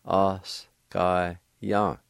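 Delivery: a sky yang, stretched out slowly; very slow pronunciation. Shown as a demonstration. Teacher models the first part slowly for students. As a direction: draw out a sky yang carefully. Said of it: "First part is pronounced like this".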